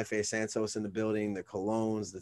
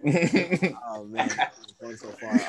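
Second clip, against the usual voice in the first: usual speaking voice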